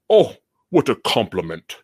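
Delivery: lisping fake British accent; mock bootleg British, lisped; a hint of bad British with a lisp. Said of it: "my British is just as bad as Kiefer Sutherland's".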